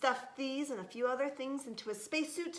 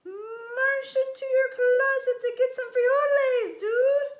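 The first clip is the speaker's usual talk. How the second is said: very high, loud alien voice; Martian voice; 12 dB louder than usual. A narrator's reading. Mystery voice answers the space kitten, high loud Martian. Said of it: "said the voice".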